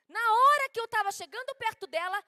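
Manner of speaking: usual speaking voice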